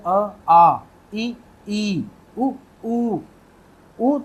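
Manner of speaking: teacher contrasts short and long vowels; vowel length demo. Shown as a demonstration. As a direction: draw out each long vowel. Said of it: "और लंबाई देखिए इनकी".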